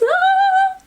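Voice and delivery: long, very high "ah"; high-pitched squeal. Real, not acted. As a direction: squeal before the big reveal. Squeals before announcing next book.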